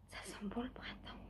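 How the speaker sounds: whispered, soft; hushed whisper of delight. Real, not acted. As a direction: breathe it out as you smell flowers. Smelling the flowers, hushed.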